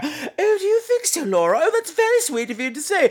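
put-on high, flattered, genteel old English lady; prim British lady. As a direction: coo back at a compliment. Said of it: "the conversations are always like".

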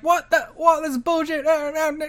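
high, squeaky mock protest; mock outraged wrestler. Wrestlers banned from ringside protesting, high mock whine. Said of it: "now you're just like".

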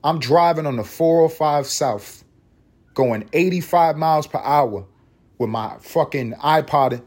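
ordinary delivery

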